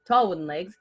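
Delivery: ordinary delivery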